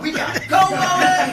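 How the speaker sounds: shouted high through laughter; urgent laughing yell to leave now. Retold or imitated. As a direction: yell it while cracking up. Urgent exit with laughing friend.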